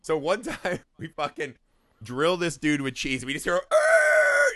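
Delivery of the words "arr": huge high roaring shout; angry yell. Retold or imitated. Enraged driver roars at the kids pelting him with cheese, high furious yell.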